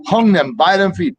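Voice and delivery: ordinary delivery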